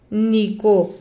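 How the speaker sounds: "único" as strong Ú, pause, weaker "nico"; stress on first syllable. Shown as a demonstration. Teacher marking the strong syllable, único led by Ú. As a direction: split único after a punched Ú. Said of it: "uma fortona e sobram duas fracas".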